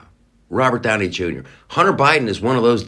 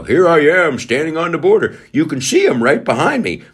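higher, louder mock reporter voice; mock video report; 7 semitones higher, 4 dB louder than usual. Conservative filming at the border, mock TV report voice. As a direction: ham up a politician's border video. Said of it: "makes himself a little video".

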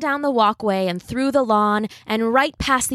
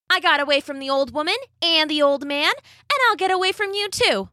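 high, taunting cookie voice; gingerbread man; 7 semitones higher than usual. A narrator's reading. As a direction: brag like a cheeky cookie. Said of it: "said the gingerbread man".

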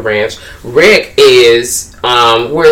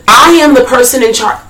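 mock cop; 10 semitones higher than usual. Mocking the power-tripping cops.

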